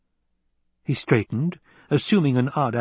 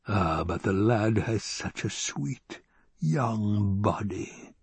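slow, soft, lingering villain voice; creepy, drawn-out relish; leering Baron; 5 dB quieter, much slower than usual. Acted. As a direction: linger softly over each word.

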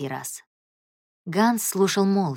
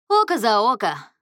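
agreeing chime-in; 6 semitones higher, 4 dB louder than usual. A narrator's reading. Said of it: "поддакнул Акерман".

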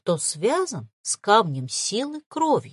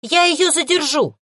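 determined; 8 semitones higher, 6 dB louder than usual. A narrator's reading. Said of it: "решительно".

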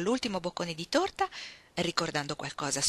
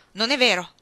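loud, higher-pitched protest; indignant denial; 4 semitones higher, 9 dB louder than usual. A narrator's reading. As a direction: hotly reject the accusation. Grady denies the accusation.

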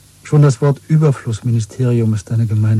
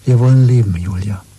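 passionate cry; 5 dB louder than usual. Acted. Leidenschaftlicher Ausruf.

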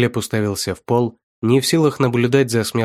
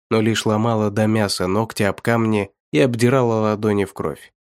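ordinary delivery

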